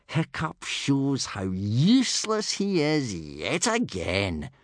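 slow, mildly smug sneer; bully lightly mocking Hiccup; snort. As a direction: gloat at the rival's failure. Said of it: "snorted Snotlout triumphantly".

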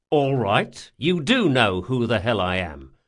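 usual speaking voice

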